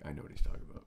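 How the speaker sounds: low, hushed aside; mock knowing whisper. One of few viewers who get the reference, hushed knowing aside. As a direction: murmur it like an insider.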